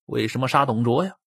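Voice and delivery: plain reading voice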